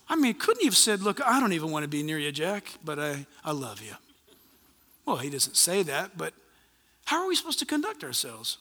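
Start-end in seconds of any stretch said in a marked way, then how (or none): 1.0-4.0 low voice sinking softer to the end; imagined tender admission. Acted. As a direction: drop low and soft, as if confiding. Imagining the Lord's blunt loving reply.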